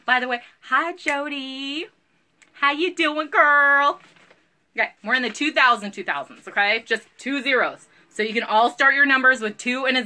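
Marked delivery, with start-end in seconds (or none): 0.6-4.0 drawn-out sweet greeting. Acted.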